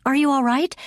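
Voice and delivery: high, frantic cry. A narrator's reading. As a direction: cry out in alarm. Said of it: "she cried".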